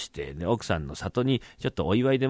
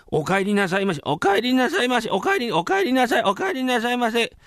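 high-pitched, hurried repeated greetings; flustered welcome; 12 semitones higher, 4 dB louder than usual. Acted. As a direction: greet the master in a caught-out fluster. Caught servants greet the returning master.

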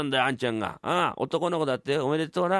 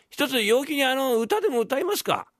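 cheery offer; 11 semitones higher than usual. Acted. Hachigoro cheers up the lord.

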